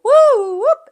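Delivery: very high, very loud piercing woo whoop; woo whoop call. Shown as a demonstration. Teachers calling the whole school to attention, piercing high whoop. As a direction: pierce through the room with it. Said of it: "everybody would go".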